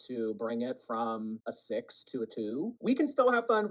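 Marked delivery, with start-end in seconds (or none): 2.8-3.7 bright emphatic promise; excited. Real, not acted. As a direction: hit CAN with a big lift. Excited reassurance to viewers.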